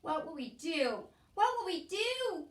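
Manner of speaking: loud, high, slow wail. A narrator's reading. Cook wailing in despair to Louise, loud and high. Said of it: "wailed Cook".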